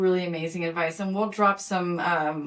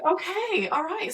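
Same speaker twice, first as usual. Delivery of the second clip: caught off guard; 10 semitones higher, slower than usual. Retold or imitated. Taken aback by daughter's answer.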